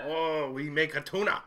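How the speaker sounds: hammed-up fake Japanese accent; bad, jokey Japanese accent; mock Japanese accent. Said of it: "I'm doing Chinese and Japanese somewhat accents".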